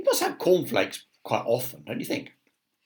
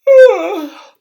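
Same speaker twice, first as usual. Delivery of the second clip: big yawn; 19 dB louder than usual. Acted. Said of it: "who yawned".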